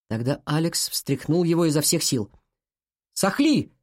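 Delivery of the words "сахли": high, insistent call of a name; raised-pitch cry to wake him; urgent call. A narrator's reading. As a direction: call out to rouse someone unconscious. Shaking his unconscious friend hard.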